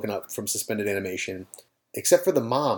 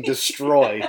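robot voice. Retold or imitated. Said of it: "the robot is literally yelling".

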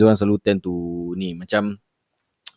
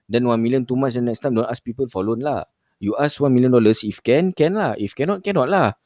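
scam caller voice; 6 semitones higher than usual. Retold or imitated. Caller haggling loan amounts.

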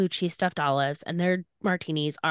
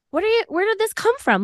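high-pitched, incredulous questioning; retold bewilderment; 14 semitones higher, 3 dB louder than usual. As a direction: blurt it out in a high, baffled voice. Retells her baffled reply to him.

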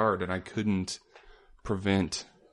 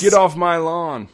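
slow, loud cranky yell, much higher; grumpy old man yell; 8 semitones higher, 10 dB louder, slower than usual. Retold or imitated. Grumpy neighbor shouting at the neighborhood kids, slow loud yell.

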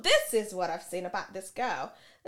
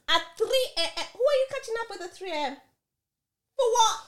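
higher, louder, fast demanding questions; indignant interrogation; 12 semitones higher, 4 dB louder, faster than usual. Retold or imitated. To her boyfriend about 3 AM plans, indignant demand. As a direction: pin him down, voice climbing with outrage.

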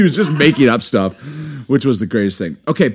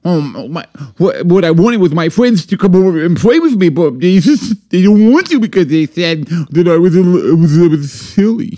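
put-on loud, higher, stammering little-boy voice; baby boy; 3 semitones higher, 5 dB louder than usual. Shown as a demonstration. Small boy complaining friends won't play, imitated baby-boy whine. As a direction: whine like a tiny child left out, stumbling over words. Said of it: "I'll do a baby boy".

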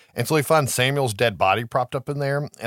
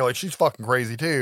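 ordinary talk

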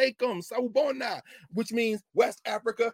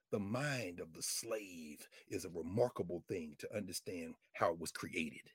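slow, very low and soft; hushed reflection; 13 semitones lower, 15 dB quieter than usual. Real, not acted. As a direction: ponder it slowly, almost under the breath. Solemn reflection after loud outburst.

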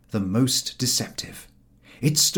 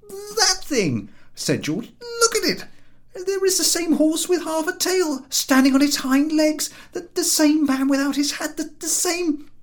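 high, louder, worked-up rant; exasperated George; 4 dB louder than usual. A narrator's reading. George pointing out the statue, exasperated and loud. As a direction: sputter with exasperation.

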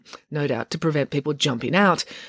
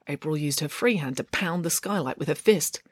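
usual speaking voice

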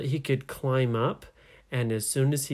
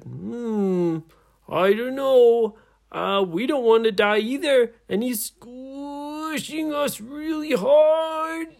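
high, loud pirate straining under a squeeze; hammed-up squished groan, stretched out; squashed pirate; 11 semitones higher, 5 dB louder, slower than usual. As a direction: wail slowly as if being crushed. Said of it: "Like that".

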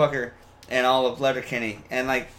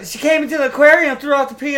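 very high, loud character squeal; Shorzy; 12 semitones higher, 7 dB louder than usual. Retold or imitated. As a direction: chirp a rival in a put-on high voice. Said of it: "that's what he says".

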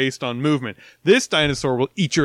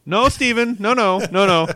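raised, louder mock objection; mock protest; 8 semitones higher, 6 dB louder than usual. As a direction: object in playful alarm, higher and louder. Acted objection to Spielberg's scene.

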